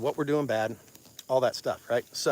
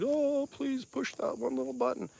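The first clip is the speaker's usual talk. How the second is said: high, softer hammed-up plea; mock pleading; 10 semitones higher, 7 dB quieter than usual. Content creator begging viewers to hit like, mock pleading. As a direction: beg like a needy creator, tongue in cheek. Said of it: "we all beg and plead".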